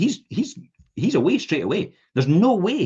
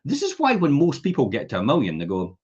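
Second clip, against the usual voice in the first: ordinary talk, nothing marked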